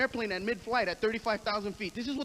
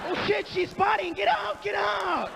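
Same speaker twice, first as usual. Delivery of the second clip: mock alarm shout; 6 semitones higher, slower than usual. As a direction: shout it like a panicked bystander.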